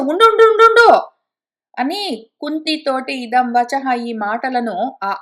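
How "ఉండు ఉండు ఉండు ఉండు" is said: loud, high urgent call of stop. Acted. Madri calls to Kunti from afar, loud urgent cry. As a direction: shout across to halt her, voice up high.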